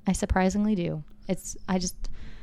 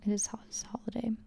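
hushed whisper; 3 semitones higher, 12 dB quieter than usual. Real, not acted. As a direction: whisper it like a secret. Whispered aside about Jesus.

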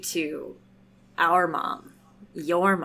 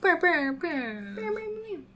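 playful high bouncing boings; boing sounds; 11 semitones higher, much slower than usual. Pretend. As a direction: bounce it like a cartoon spring. Cheering patrons with bounce sounds.

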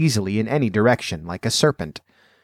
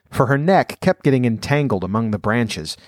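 usual speaking voice

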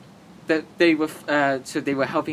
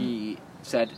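ordinary talk

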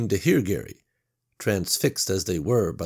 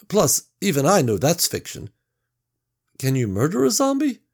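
louder, lecturing to himself; nerdy musing Gary; 5 semitones higher, 5 dB louder than usual. Acted. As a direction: reason it through, then ask high. Gary muses on zombie lore.

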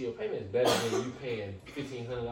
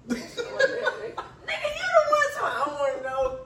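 laughing; 5 dB louder, slower than usual. Real, not acted. Laughing at a friend.

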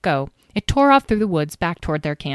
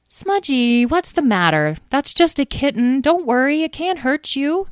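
high-pitched, cooing reassurance; gentle singsong comforting; soothing; 7 semitones higher than usual. A narrator's reading. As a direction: coo to a frightened pet. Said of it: "Talora spoke soothingly to Smudge".

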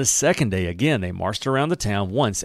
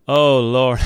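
exasperated groan; 2 semitones higher, 7 dB louder than usual. Retold or imitated. People groaning at the plan.

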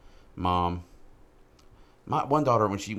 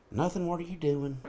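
much higher, sweet mama voice; mother's voice; 10 semitones higher than usual. Retold or imitated. Son imitating his mama on the phone, much higher. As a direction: answer like a Southern mama. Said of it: "She's like".

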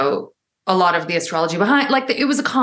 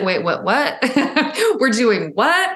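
mock disbelief, pitched up; surprised sister; 6 semitones higher, slower than usual. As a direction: play the stunned sister asking twice. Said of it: "she was like".

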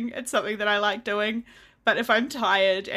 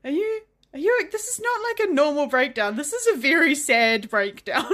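concerned colleague; 5 semitones higher, 4 dB louder than usual. Retold or imitated. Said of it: "She's like".

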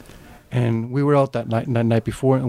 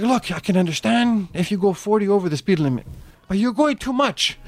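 high-pitched, pleading reproach; reasoning traffic cop; 6 semitones higher than usual. Retold or imitated. Storyteller plays the cop who pulled him over, reasonably scolding.

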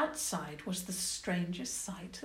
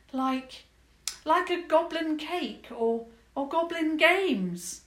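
higher, louder, hesitant and hopeful; hesitant princess; 8 semitones higher, 5 dB louder than usual. Acted. Princess suggests goblin games, hopeful higher voice. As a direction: fumble for ideas eagerly.

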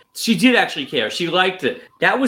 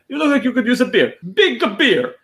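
lightly voiced broken-English waiter, higher; a hint of a foreign waiter's halting English; 7 semitones higher than usual. Waiter sizing up an American tourist, in mock broken English. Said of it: "in broken".

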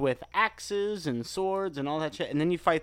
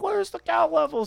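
high, louder mimicked question; pitched-up mock player question; everybody clamoring; 5 dB louder than usual. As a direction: imitate a puzzled crowd asking. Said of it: "everybody was like".

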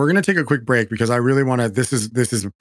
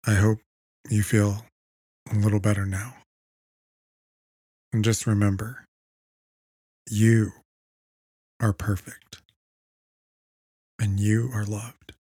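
soft spa whisper; 5 dB quieter than usual. Acted. Host leads a meditation break.

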